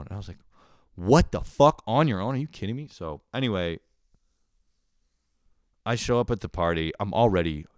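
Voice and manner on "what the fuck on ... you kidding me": retold disbelief. Retold disbelief at party plan.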